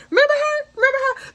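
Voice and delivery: high, sing-song gloating taunt; teasing taunt. Pretend. Taunting the convicted mother about her sister-in-law, gleeful. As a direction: needle her with glee.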